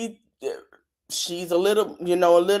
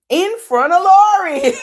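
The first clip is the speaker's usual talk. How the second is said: high, loud laughing outburst; real shocked laughter; laughing shout; 11 semitones higher, 8 dB louder than usual. Real, not acted. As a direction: shriek it through a laugh in disbelief. Shocked at the flicked finger.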